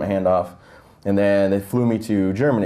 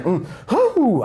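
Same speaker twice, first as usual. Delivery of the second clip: high hum ending in a whoosh; strained hum and exhale; 13 semitones higher than usual. Retold or imitated. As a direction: force out a teary whoo. Trying and failing to cry.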